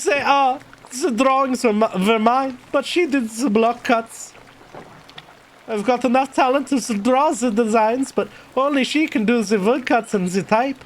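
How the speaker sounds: German character voice; German accent. Acted. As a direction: play Klaus with a put-on German accent. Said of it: "I was doing a fucking German voice for".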